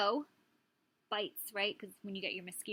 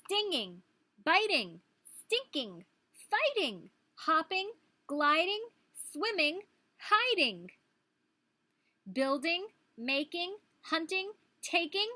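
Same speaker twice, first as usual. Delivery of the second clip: higher, louder bouncing list; excited read-aloud; 7 semitones higher, 7 dB louder, slower than usual. Acted. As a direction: read the list with rising delight. Reading rhyming list aloud.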